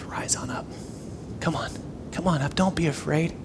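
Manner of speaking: very soft, near-whispered comfort; gentle reassurance. Acted. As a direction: coax frightened friends up tenderly and quietly. Jesus gently reassures frightened disciples.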